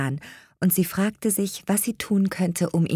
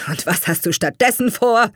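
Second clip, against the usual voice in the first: mildly sneering father, pitch lifting; höhnisch; 5 semitones higher, 4 dB louder than usual. A narrator's reading. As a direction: challenge him with a touch of scorn. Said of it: "fragte sein Vater höhnisch". (leicht höhnisch nachgefragt)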